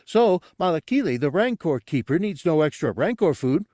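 high character voice, pleased with his theory; high-pitched dim sleuth; eager simpleton. Acted. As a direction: explain it proudly in a high voice. Gartog continues his muddled theory.

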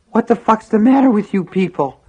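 desperate high, loud gasping yell; wounded man's frantic outcry; ragged gasp. A narrator's reading. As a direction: gasp it out angrily, straining for air. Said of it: "Henry gasped raggedly".

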